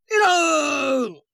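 very high, long-drawn parade-ground shout; shouted command. Acted. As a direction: bellow the order to the officers, stretching it out. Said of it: "冯国璋提高了嗓门喊了一声".

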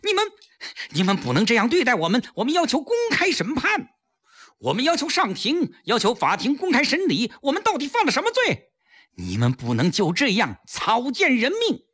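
high-pitched, indignant protest; sudden outburst. A narrator's reading. Prisoners demand a public trial, outburst of protest. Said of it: "情绪突然爆发".

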